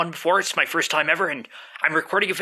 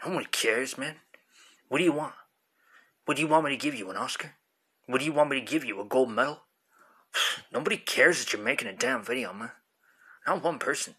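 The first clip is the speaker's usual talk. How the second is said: bored stoned dude, dismissive; arguing with myself; 4 semitones lower, 5 dB quieter than usual. Acted. One man plays both sides.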